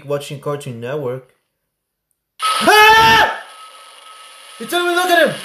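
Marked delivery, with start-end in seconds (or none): 2.4-3.4 sudden high-pitched scream of fright; startled scream. Real, not acted. Gamer jump-scared by Herobrine, loud high scream. 4.6-5.4 high, loud, frightened accusation; panicked protest. Real, not acted. Gamer blaming the game after a scare, high panicked cry.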